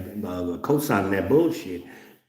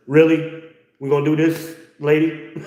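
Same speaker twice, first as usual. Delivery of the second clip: higher, louder mock mother; mock indignant mother; 6 semitones higher, 4 dB louder than usual. Mother challenging her son, pitched up. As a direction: play mom squaring up.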